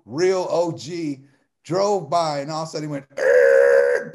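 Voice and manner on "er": deep throaty appreciative growl, much lower and louder; a low, impressed growl from the gut; low growl of approval. Retold or imitated. Old OG reacting to passing woman.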